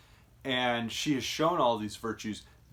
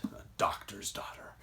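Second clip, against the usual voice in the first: whispered; 2 semitones lower, 7 dB quieter than usual. Retold or imitated. Lords whisper in horror.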